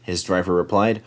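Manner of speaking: plain reading voice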